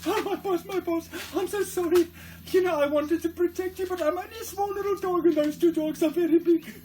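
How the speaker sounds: small dog sorry to its injured master, high voice; little dog's voice. Retold or imitated. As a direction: squeak out your apology to your master. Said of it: "the doggy says".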